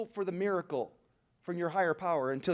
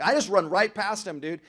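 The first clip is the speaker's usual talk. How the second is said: ordinary talk, nothing marked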